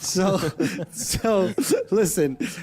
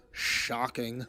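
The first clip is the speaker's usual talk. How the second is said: deadpan; 10 semitones lower, 11 dB quieter than usual. Retold or imitated. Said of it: "Mark literally looks at him and goes".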